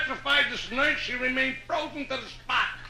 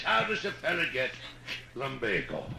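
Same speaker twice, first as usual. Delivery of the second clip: put-on much lower, softer drunk mumble; drunk Irishman; 12 semitones lower, 8 dB quieter than usual. Drunk Pat asking priest.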